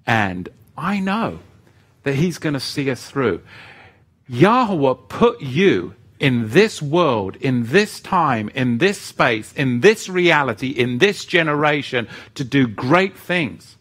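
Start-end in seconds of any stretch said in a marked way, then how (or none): 4.3-13.8 preaching. Acted. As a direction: hammer each "in this" like a sermon. Preaching to viewers.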